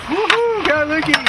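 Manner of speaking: high whooping cheer for a mate; excited cheer. Real, not acted. Cheering his mate on as the fish comes up, excited whoop. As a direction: yell it out like your mate just hooked a big one.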